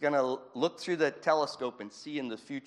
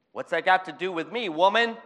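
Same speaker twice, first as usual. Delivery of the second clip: mock indignant Jesus; 6 semitones higher, 9 dB louder than usual. Jesus rebukes Mary at Cana.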